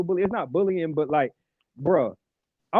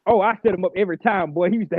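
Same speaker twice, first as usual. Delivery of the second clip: ordinary delivery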